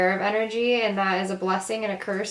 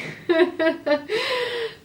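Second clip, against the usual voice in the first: high-pitched giggling fit; real laughing; 14 semitones higher than usual. Real, not acted. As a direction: giggle high at your own joke. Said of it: "I'm laughing".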